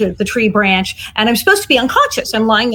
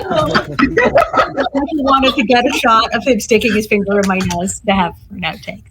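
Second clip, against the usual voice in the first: usual speaking voice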